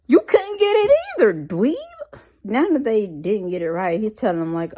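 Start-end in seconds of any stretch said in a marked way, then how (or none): 0.1-2.2 loud, high-pitched sibling squabble; hammed-up kid-brother outrage; indignant comeback. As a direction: fire the insult back at a brother. Acted sibling quarrel.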